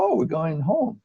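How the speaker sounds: ordinary talk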